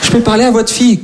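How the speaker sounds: put-on high, nervous little-boy ask; boy calls the house, shy and high; timid boy voice. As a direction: ask politely with your voice way up. Boy calling girl's home.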